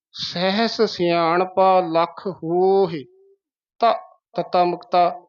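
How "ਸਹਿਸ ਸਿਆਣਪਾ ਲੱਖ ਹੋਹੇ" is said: slow, ਹੋਹੇ as long "hooo-hi"; careful model reading. Shown as a demonstration. Teacher modelling the scripture line, slow careful pronunciation. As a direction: recite slowly, ਹੋਹੇ released on short i. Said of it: "ਹਾਹੇ ਤੇ ਆਵਾਜ਼ ਛੱਡ ਦੇਣੀ ਆ".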